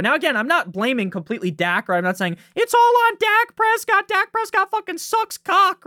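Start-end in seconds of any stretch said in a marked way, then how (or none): 2.5-5.9 mock ranting fan. Host mimics fans blaming Dak Prescott, high mock rant. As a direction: jump way up in pitch to mock the haters.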